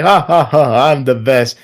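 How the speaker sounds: mock villainous taunting; taunting rival. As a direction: sneer like a boastful bully rival. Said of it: "not a rival like".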